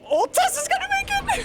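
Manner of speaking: very high, fast squeaky take. Pretend. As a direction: squeal it like a cartoon.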